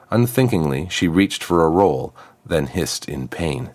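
ordinary delivery

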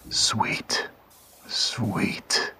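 breathless whisper, savouring each word; hungry whisper. A narrator's reading. The wolf craving the girl's blood, whispered. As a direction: whisper it like a beast smelling blood. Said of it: "the wolf whispered".

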